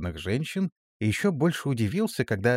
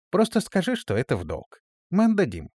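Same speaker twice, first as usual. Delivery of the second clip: pushy gnome woman; 8 semitones higher than usual. Acted. Gnome pushes keeper.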